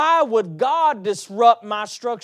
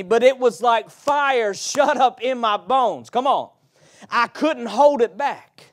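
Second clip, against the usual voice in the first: louder preacher's voice, pressing; preaching; 3 semitones higher, 6 dB louder than usual. Acted. Preacher quotes Jeremiah to the congregation, preaching. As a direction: let Jeremiah's fire push through.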